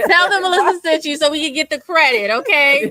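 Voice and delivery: laughing plea, pitched high and loud. Real, not acted. Host jokingly begs viewers for referral credit, laughing. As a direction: plug yourself loudly through real laughter.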